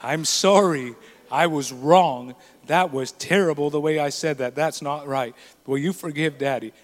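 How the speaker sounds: low-pitched, sincere contrition; humble apology. Retold or imitated. Dad apologizing to a little child, humbly earnest.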